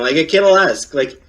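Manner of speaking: normal talking voice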